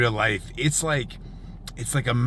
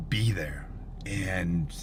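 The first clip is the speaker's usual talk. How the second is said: hushed awe; 6 semitones lower, 6 dB quieter than usual. Real, not acted. Awed storm chaser reflecting.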